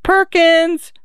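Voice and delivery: put-on high childlike calling; drawn-out, high-pitched boyish calls; chirping boys. A narrator's reading. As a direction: chirp the name like searching boys. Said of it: "a chirping chorus of".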